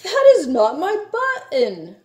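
loud, high, exasperated storybook shout. A narrator's reading. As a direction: shout it in frustration. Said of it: "shouted Toad".

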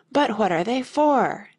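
slow, eager and curious asking. A narrator's reading. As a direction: lean in, keen to know. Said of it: "Alice asked in a tone of great curiosity".

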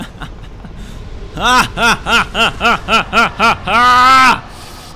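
long, loud, high gloating laugh; big staccato villain-style laugh ending drawn out; victory cackle. Real, not acted. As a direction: cackle triumphantly. Gloating after boss victory.

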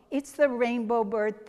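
usual speaking voice

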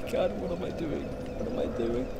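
breathless whisper to himself. Real, not acted. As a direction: whisper it in disbelief. Ghost pepper panic, muttering alone.

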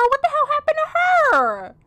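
very high, loud disbelieving yell; shocked yell. Real, not acted. Shocked at drunk sim.

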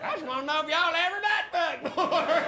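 lightly voiced country attendant; Southern accent. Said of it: "the guy from the gas station".

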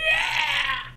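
high-pitched mock battle scream; imitated scream. Reactor imitating the screaming character on screen, high-pitched scream. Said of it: "he's screaming".

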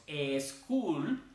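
"es school" with a Spanish E before the S; Spanish-accented "es school"; added E. Shown as a demonstration. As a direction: start "school" on an "eh" vowel. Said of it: "le metemos una E al principio".

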